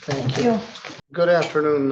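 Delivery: ordinary delivery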